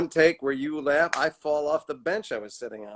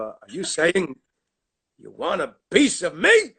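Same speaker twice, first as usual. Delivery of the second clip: Frank Costanza rage; 4 semitones higher, 6 dB louder than usual. Retold or imitated. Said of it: "he did that line in the first take".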